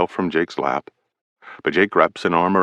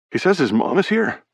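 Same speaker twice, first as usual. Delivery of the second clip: higher, uneasy teammate voice; anxious Brady; 11 semitones higher than usual. A narrator's reading. Teammate Brady tells Carlson Teddy is freaking out, higher and uneasy. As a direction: shrug it off but worried.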